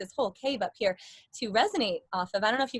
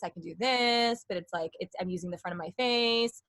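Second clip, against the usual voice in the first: low voice placed forward; lower voice; 3 semitones lower than usual. Shown as a demonstration. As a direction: drop the pitch, keep it in the front of the face. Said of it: "if I wanna have, like, a lower voice".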